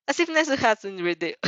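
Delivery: usual speaking voice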